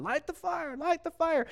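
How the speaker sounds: high-pitched imitation of frantic pleading; mock screaming. False prophets begging their god for fire, mock screaming. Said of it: "screaming for their God to do something".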